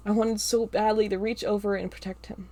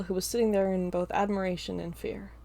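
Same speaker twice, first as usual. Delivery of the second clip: plain reading voice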